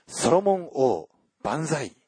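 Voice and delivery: a lightly acted cry of long live the king; crowd cheer. A narrator's reading. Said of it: "と叫んだ".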